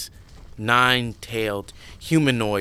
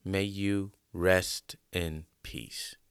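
quiet, solemn dedication; 4 semitones lower, 8 dB quieter than usual. Real, not acted. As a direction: speak softly, in memory. Solemn dedication before story.

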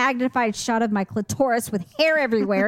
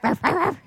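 mock dog barks, raised pitch; barking; 6 semitones higher than usual. Woman barking at yappy dogs to tease them, mock barks. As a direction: yap back like a small dog. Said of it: "I'm like".

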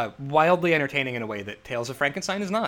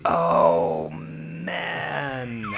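big agonized groan; exasperated groan. Real, not acted. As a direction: groan it out, stretching both words. Groaning at a forced choice.

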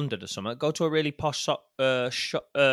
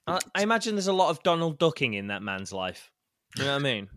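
ordinary talk, nothing marked

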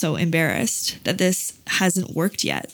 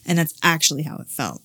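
ordinary talk, nothing marked

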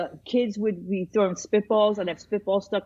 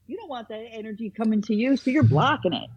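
ordinary talk, nothing marked

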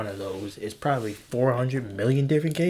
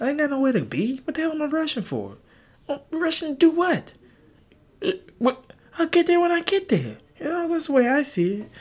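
high carefree; 4 dB louder than usual. Real, not acted. Carefree driving philosophy.

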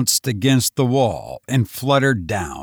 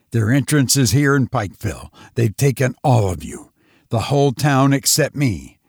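ordinary delivery

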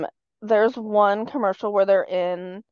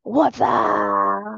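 Wassup catchphrase; 4 dB louder than usual. Retold or imitated. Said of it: "make it go on forever".

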